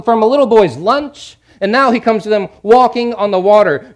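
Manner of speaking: high, loud, building sermon list; preaching at full force. Real, not acted. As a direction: drive each miracle home with mounting fervour.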